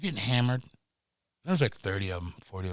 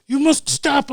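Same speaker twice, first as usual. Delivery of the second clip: mock cop order; 12 semitones higher than usual. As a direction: bark it like a French cop. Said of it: "They're like".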